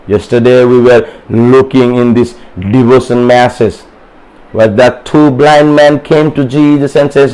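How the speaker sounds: usual speaking voice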